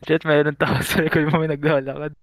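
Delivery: alarmed warning. Real, not acted. Takot na babala sa laro.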